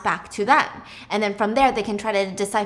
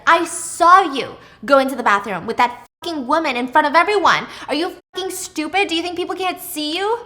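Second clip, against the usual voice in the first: retold furious yelling, higher and louder; angry yelling; 6 semitones higher, 6 dB louder than usual. Margot yelling at her husband about the bathroom, angry and loud. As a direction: tear into him like a jealous wife. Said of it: "Margot's yelling at Brent".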